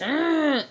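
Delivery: loud, high disgusted "ugh"; frustrated groan. Retold or imitated. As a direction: groan at the slow driver. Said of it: "and I was".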